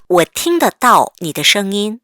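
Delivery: slow, careful reading; slow read-aloud. Shown as a demonstration. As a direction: model each syllable slowly. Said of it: "같이 읽어볼게요". (천천히 또박또박 읽기)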